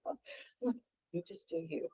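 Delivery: very soft, skeptical aside; private thought aloud. Retold or imitated. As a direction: mutter it to yourself, acting out the thought. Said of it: "I'm thinking to myself".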